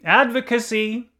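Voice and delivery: a high, urgent cry of "Advocacy". A narrator's reading. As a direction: call out like rallying a crowd. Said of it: "cried Persons".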